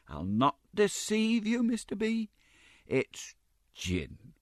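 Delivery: high, slow mock-candid confession; confiding Mrs Mann. A narrator's reading. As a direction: own up sweetly.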